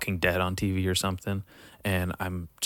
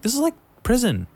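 mock shock; 11 semitones higher, 4 dB louder, faster than usual. Arriving at camp, shocked.

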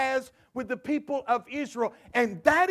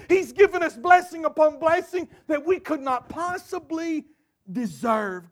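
ordinary talk

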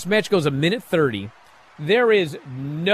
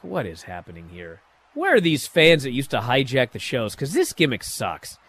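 softer, lower disbelief to himself; muttered aside; 5 semitones lower, 6 dB quieter than usual. Retold or imitated. The host reacting to the crowd singing, a soft disbelieving grumble. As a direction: grumble it under your breath. His own thoughts during the promo.

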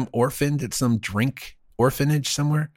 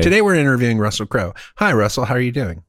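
faster redo; faster than usual. Acted. Redo of interview intro, faster.

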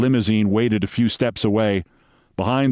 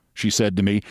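usual speaking voice

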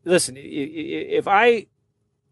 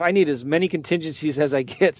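ordinary talk

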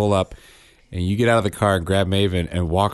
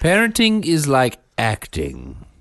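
mock book promo; 2 semitones higher than usual. Husband pitching a fake parenting book, mock-grand. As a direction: announce it grandly, stretching each word.

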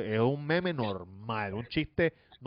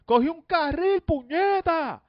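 high shrill-pitched yelling, much louder; mock screaming; 7 dB louder than usual. Imitating Pamela screaming at her coworkers, high loud yell. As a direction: scream it like a furious coworker fed up with abuse. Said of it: "ella empieza a gritar".

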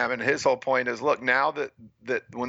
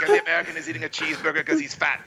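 much higher, fast, laughing mock commentator; mock announcer; faster than usual. Imagining the announcer mocking the American, a high fast laughing put-down. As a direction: rattle off the jab like a snide broadcaster. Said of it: "waiting for him to be like".

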